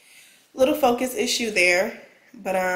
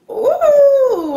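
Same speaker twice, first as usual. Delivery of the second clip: high delighted squeal; thrilled at first sight; excited ooh; 8 dB louder than usual. Real, not acted. Unboxing the camera, delighted.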